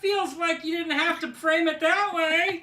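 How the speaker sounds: lightly voiced, high bird voice, a bit hurt; a hint of an offended Big Bird. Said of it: "It's Sesame Street's Big Bird".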